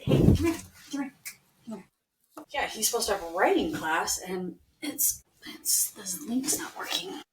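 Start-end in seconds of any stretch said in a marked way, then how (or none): 0.0-1.8 calling her dog during a recording. Real, not acted. 4.8-7.2 slow, high whispered aside; hushed frustration over the broken link. Real, not acted. Muttering to child mid-podcast.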